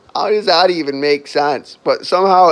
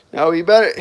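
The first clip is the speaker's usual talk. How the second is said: normal talking voice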